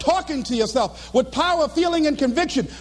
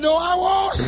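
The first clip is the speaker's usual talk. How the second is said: high, hammed-up wail; mock crying refusal; tearful protest; 5 semitones higher than usual. Worn-out person saying they can't, wailing.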